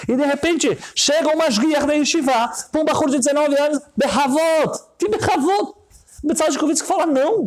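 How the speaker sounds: high-pitched, heated sermon delivery; impassioned preaching. Acted. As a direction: build to an incredulous high-pitched protest. (pregação exaltada, em tom agudo)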